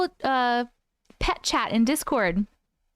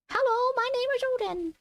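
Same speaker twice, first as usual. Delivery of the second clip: much higher squeaky cat voice; voicing the cat; 13 semitones higher than usual. Giving her cat Odin a voice to greet viewers, tiny and high.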